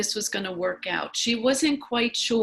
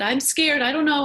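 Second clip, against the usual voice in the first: put-on higher and louder, a frightened child's voice; scared little girl; 6 semitones higher, 8 dB louder, faster than usual. A narrator's reading. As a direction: voice her fear as the bike wobbles. Girl on wobbling bike.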